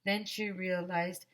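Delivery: ordinary delivery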